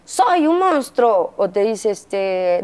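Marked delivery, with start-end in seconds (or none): 0.1-1.3 slow, high mock monster growl of a little boy; angry child voice. Mother imitating her angry son, slow and high. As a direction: drag it out like an angry kid playing monster. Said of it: "cuando se enoja te dice".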